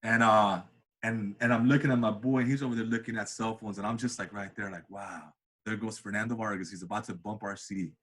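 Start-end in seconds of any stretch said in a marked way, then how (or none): 4.3-7.9 hushed. Retold or imitated. Hushed anticipation watching car.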